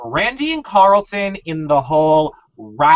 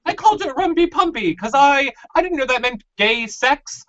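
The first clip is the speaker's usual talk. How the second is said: mock high Lisa; 9 semitones higher than usual. Mocking Lisa quote.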